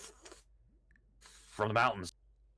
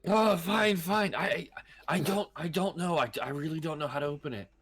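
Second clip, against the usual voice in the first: panicky, stammering, pitched up; nervous stammer; 6 semitones higher than usual. Acted. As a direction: stumble over every word in fear. Captive cracking under interrogation.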